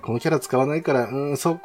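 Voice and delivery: usual speaking voice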